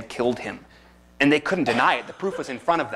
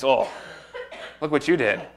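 mocking devil; 6 semitones higher than usual. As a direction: taunt them like a gloating devil. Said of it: "Now he says".

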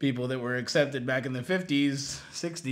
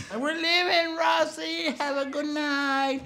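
high, singsong farewell; called-out goodbye; 14 semitones higher than usual. Retold or imitated. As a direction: imitate friends calling out as they leave. Friends calling goodbye.

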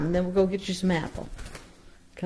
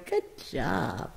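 quiet, low murmured praise; soft praise; 5 semitones lower, 8 dB quieter than usual. Real, not acted. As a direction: murmur it gently to the bird. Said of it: "praise repeated, soft then high".

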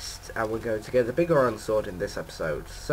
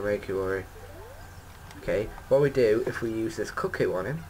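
normal talking voice

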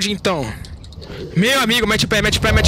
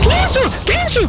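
startled; 12 semitones higher than usual. Real, not acted. Surprised by two crabs.

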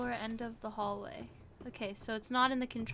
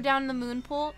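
normal talking voice

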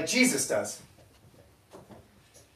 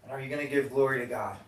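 soft, hushed altar-call voice; quiet appeal; 6 semitones lower, 5 dB quieter than usual. Acted. Pastor asks the room about buried sin, hushed.

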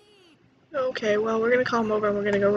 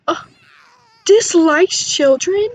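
slow and louder, drawn-out disbelief; shocked reading; 5 semitones higher, 8 dB louder, slower than usual. Real, not acted. Player reading the Sim's traits aloud, slow and shocked. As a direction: stretch each word out in shock.

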